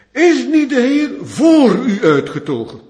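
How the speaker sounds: loud, high ringing question; rally him with a ringing call; rousing challenge. Acted. Deborah rouses Barak to battle with a loud, high call.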